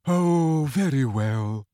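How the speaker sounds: reluctant, resigned giving in; weary concession falling away. A narrator's reading. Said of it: "looking resigned".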